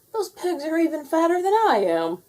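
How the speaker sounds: high, put-on mayor voice; mayor's thought. A narrator's reading. The mayor eyeing the pigs, high pompous voice. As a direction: sneer at the fat pigs, pompously.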